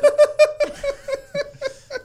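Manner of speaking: high squealing burst of laughter; shrieking laugh. Real, not acted. Group laughing at joke.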